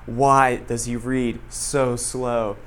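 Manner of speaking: drawn-out, slowed-down whisper-to-a-friend question; mock slow reading. Student mocking teacher's pace to friend, slowed right down. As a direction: stretch each word like the slow-reading teacher. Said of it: "he just read it so agonizingly slow".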